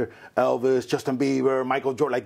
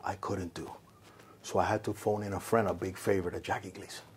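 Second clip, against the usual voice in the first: [name] telling quietly; 7 semitones lower, 6 dB quieter than usual. Retold or imitated. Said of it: "and Frank goes".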